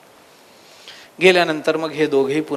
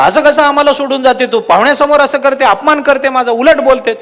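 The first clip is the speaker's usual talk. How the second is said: a touch of staged anger, slightly raised; mock angry scolding; 7 semitones higher, 5 dB louder than usual. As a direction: play the fake-furious husband for the guest. Husband feigns anger at wife. (दिखाऊ रागाने ओरडणे, थोडेसे)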